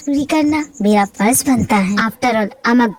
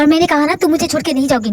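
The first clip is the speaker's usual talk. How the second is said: ordinary delivery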